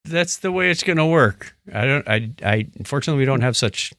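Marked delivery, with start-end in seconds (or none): none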